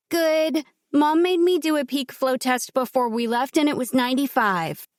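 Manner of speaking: put-on high, bright child speaking up; young girl voice. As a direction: play the little girl answering the doctor. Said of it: "Miss Morgan".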